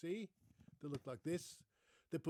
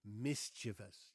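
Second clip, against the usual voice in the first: slow "Mischievous" as MIS-chuh-vus; three slow syllables in "Mischievous", first one stressed; 5 semitones lower, slower than usual. Shown as a demonstration. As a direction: spell out "Mischievous" by ear, hitting MIS. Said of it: "stress is on the first syllable".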